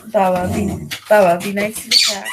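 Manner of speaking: firm dog scold. Real, not acted. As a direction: tell the dog off sharply.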